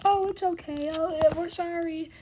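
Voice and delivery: put-on high, loud sing-song sorry to a crying kid; mock comforting. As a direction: coo an apology to soothe the child.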